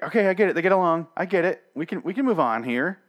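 high, pushy mock exasperation; exasperated impatience. Viewer tired of repetitive cast interviews, exasperated impatience. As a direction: act out being fed up, hurrying them along.

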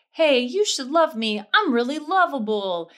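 brightly hammed-up confidence; upbeat self-affirmation. Acting out her confident days.

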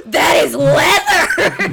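slow loud amazed exclaim; shocked gasp. Retold or imitated. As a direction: gasp it out in disbelief. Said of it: "you're like".

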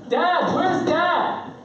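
put-on high, drawn-out child's call; kid calling for Dad. Kid seeks dad hiding in bathroom, high drawn-out mock call. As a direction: imitate an insistent kid searching.

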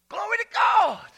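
high, loud shout of glory; exultant praise. Real, not acted. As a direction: cry it out in praise. Exclaims praise.

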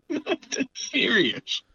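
high, drawn-out words broken up by laughing; laughing insistence. Real, not acted. Guest assures friend that people really asked for money, laughing. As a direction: say it through real laughter.

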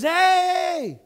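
high, loud sailor's call; yell. Retold or imitated. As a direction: yell after someone walking away. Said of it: "they yell to him".

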